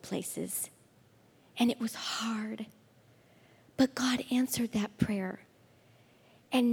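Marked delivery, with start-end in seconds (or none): 1.6-5.4 hushed, breathy whisper; whispered testimony. Real, not acted. Speaker to the ladies about her hard times, whispered. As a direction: drop to a whisper, almost confiding.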